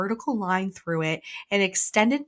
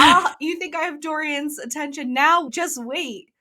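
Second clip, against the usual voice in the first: high, sassy mock threat; taunting; 8 semitones higher than usual. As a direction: taunt a rival, playfully smug. Retells heroine taunting her rival.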